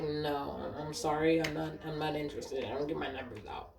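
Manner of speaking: soft, retold polite brush-off. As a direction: quietly turn down an unwanted advance. Retold polite refusal of advances.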